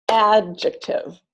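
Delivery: "Adjective" slowly, stress on the first syllable; stress pattern demo. Shown as a demonstration. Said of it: "I really recommend clapping it".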